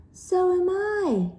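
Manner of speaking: squeaky high, slow yell; high-pitched sister yelling; high mock yell. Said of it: "yelled Gilda".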